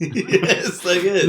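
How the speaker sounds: slow, high and loud through laughter; laughing. Real, not acted. Friend cracking up at the chat exchange, laughing. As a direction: gasp it out mid-laugh.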